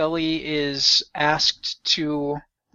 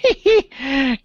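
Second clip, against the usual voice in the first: breathy laugh; 11 semitones higher, 5 dB louder than usual. Real, not acted. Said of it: "old man voice".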